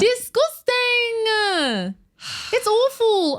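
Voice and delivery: high, long drawn-out mock wail; hammed-up outraged lament; melodramatic disgust. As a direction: wail it high, stretching out every syllable.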